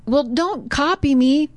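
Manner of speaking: boy calls out high, mock annoyed. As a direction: tell the echo off. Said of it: "So he's hearing the echo".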